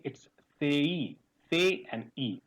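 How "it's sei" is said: sei said with an e gliding to i; correct sei. Shown as a demonstration. Teacher modelling the diphthong sei for students. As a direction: show the class the right sei. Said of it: "It is not say".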